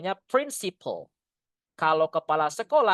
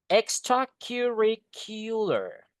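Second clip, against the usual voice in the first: Extracurricular said slowly, syllable by syllable; slow model word; 3 semitones higher, slower than usual. Shown as a demonstration. Teacher modelling Extracurricular slowly for the class. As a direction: break Extracurricular into slow clear parts. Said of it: "Extra bacanya extracurricular".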